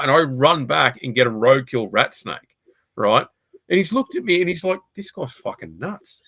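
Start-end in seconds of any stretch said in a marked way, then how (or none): 4.9-6.2 much softer, slow under-the-breath verdict; hushed, drawn-out aside; imitated muttered aside. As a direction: mimic someone quietly muttering in disbelief. Voicing the driver's private verdict.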